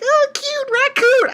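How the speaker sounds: very high, drawn-out squeal; hammed-up falsetto gushing; gushing girls. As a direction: imitate girls cooing over something adorable. Girls gushing at Chopper, acted.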